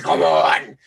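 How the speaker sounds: mock Satan-possessed voice; possessed demon voice. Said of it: "'cause she's possessed by Satan".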